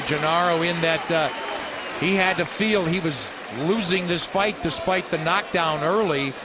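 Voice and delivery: normal talking voice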